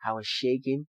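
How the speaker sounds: ordinary talk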